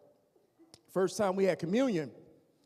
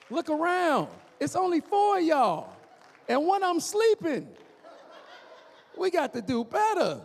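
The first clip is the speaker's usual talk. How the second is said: exasperated exclaim; 9 semitones higher, 5 dB louder than usual. Acted. Exclaiming at small crowd.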